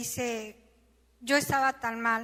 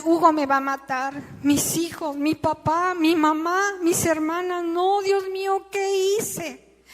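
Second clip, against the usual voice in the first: panicked fear; 6 semitones higher, 7 dB louder, slower than usual. Retold or imitated. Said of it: "era una cosa espantosa".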